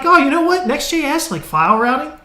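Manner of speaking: high, sing-song mock enthusiasm; mock cheerful. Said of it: "I'm like".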